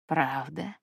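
low, quiet sinister creature; menacing monster voice. A narrator's reading. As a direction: purr it out like a predator. Monster mocks Kim's denial.

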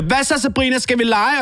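a hint of a playful cow girl; cow friend. Best friend cow inviting Sabrina to play, lightly high. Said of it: "hun siger".

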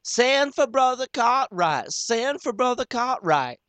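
very high, loud shrill chorus call; the women call for the preacher. Acted. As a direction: cry it out in alarm. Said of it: "all the women sings out".

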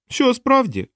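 high-pitched clown acting surprised; mock-astonished little clown; feigned surprise. A narrator's reading. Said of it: "Клоун удавав здивування".

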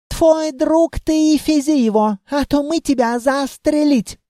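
put-on high, irate Germanic-accented threat; western elf accent. A narrator's reading. Elf threatens to shoot the carter, western accent. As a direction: threaten in a foreign officer's high voice. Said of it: "Акцент. Самый что ни на есть западный".

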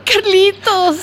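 laughing through tears; choked-up happy cry; mother sobbing with joy; tearful joy. Acted. Mother meets returning son.

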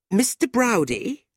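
high-pitched, pointed address; angry young woman. A narrator's reading. Fanny turning to John to spite Tilda, angry. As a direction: call him in high pique. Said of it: "looking at Tilda angrily".

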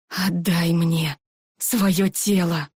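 slow low ghostly demand; drawn-out hungry ghost; menacing ghost voice. A narrator's reading.

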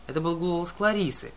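ordinary delivery

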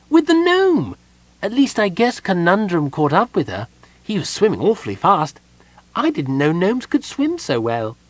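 high, bright-eyed kender chirp; perky kender greeting at the door. A narrator's reading. Kender greets Palin at the door, high and perky.

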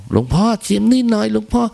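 high, pushy donor voice; showy rich donor offering the monk food; mock show-off offer. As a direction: hold the dish out and insist, high.

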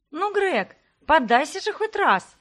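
high, loud teasing call across the lawn; ringing shout. A narrator's reading. A twin calls to Greg during their game, ringing shout. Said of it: "звонко крикнула одна из девушек".

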